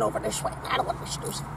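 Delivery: sleepy whispered aside. Real, not acted. Sleepy whisper about needing coffee. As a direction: breathe it out like you're dozing off.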